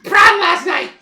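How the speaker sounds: very high, loud squeaky chatter; squeaky halfling. Acted. Fleeing halfling answers travellers' questions, squeaky and loud. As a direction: talk loudly in a tiny, squealing voice. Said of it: "this kinda squeaky voice like".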